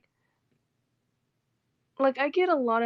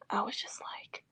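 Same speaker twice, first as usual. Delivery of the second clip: whisper; 11 dB quieter than usual. Real, not acted. Whispered lead-in to quote.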